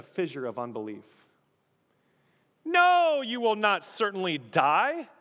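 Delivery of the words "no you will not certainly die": loud, high scoffing contradiction; emphatic denial. Acted. Acts out serpent contradicting God.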